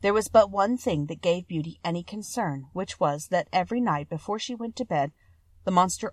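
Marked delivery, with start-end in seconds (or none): none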